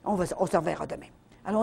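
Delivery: ordinary delivery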